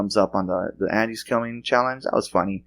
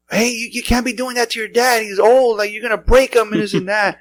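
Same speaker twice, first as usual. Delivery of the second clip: much higher mock wife voice scolding the son; 12 semitones higher than usual. Host imitates wife yelling at son, high mock scold. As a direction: play the wife telling off the kid. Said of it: "My wife runs out".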